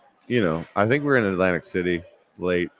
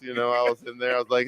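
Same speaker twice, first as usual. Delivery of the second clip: ordinary talk, nothing marked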